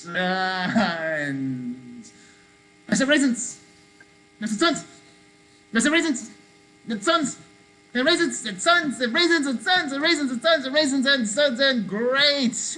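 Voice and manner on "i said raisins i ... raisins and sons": quick-fire calls. Shown as a demonstration. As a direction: rattle them off to catch the kids out. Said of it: "I might go really fast".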